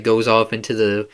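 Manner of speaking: ordinary talk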